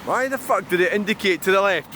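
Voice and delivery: normal talking voice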